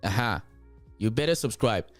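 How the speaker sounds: normal talking voice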